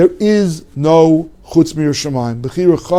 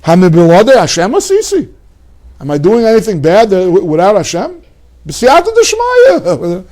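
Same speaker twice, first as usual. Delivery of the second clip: mock villain's boast. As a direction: play the arrogant heretic enjoying himself. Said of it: "he says".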